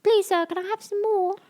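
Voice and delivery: put-on high small orphan boy voice; Oliver voice. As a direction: beg like a timid orphan.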